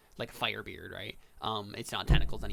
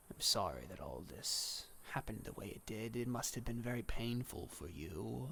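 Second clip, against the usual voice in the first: soft, whispery reaper's voice; hushed, measured doom; solemn, sorrowful Death; 4 dB quieter than usual. Acted. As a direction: console quietly as Death, with long pauses.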